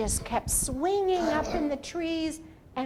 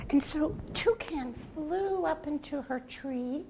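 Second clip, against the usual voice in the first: ordinary delivery, nothing marked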